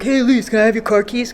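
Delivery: lightly voiced older brother; a hint of a lazy big brother asking a favor; imitating brother. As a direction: sister mimicking her brother asking for keys, lightly voiced. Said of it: "that's exactly what my brother sounds like".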